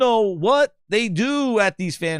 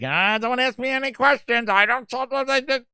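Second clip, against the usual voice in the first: high, awkward mock deflection; awkward interviewee; 6 semitones higher than usual. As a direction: imitate her squirming away from questions high up. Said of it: "Dude, she's awkward".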